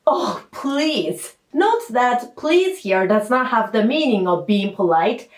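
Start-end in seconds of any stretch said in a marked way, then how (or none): 0.1-1.3 pleading. Shown as a demonstration. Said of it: "it has the nuance of".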